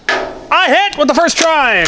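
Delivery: high, loud, slow happy shout; excited cheer. Real, not acted. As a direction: call it out proudly. Cheering a first-try hit.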